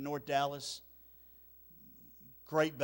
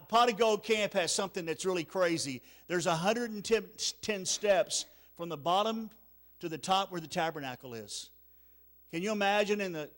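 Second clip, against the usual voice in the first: usual speaking voice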